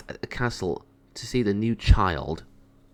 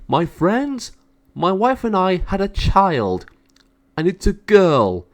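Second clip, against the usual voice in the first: much higher and louder, proclaiming to the crowd; royal announcement; 9 semitones higher, 6 dB louder, slower than usual. Acted. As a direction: announce the princess to all the subjects. King announces to his subjects.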